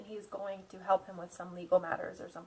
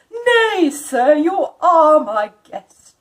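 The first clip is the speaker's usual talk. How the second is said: higher, louder, slow firm count; insistent Dracula; 5 semitones higher, 17 dB louder, slower than usual. Acted. Dracula insists Jonathan is his guest, firm and courtly. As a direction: insist with polite force. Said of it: "but he insisted".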